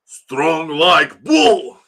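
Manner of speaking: loud, drawn-out mock Russian accent; hammed-up Russian strongman. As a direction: bellow it slowly in a thick Russian accent. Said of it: "Is Mandela Russian?".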